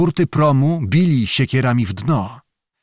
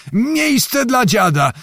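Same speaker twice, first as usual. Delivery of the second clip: Miejsce dla dziada yelled much higher and slow; old man yelling over the crush at the ferry; shrill high shout; 11 semitones higher, slower than usual. A narrator's reading. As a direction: pitch the repeated call way up to be heard. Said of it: "krzyczał Zagłoba".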